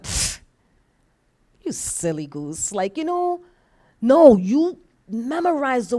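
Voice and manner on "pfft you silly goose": low, quiet, slow scoff; mocking enemy. Preacher as the enemy laughing at believers, quietly mocking. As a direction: scoff softly at a helpless victim. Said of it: "you know what the enemy's doing? He's laughing".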